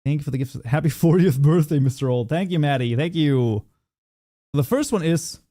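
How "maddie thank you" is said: ordinary talk, nothing marked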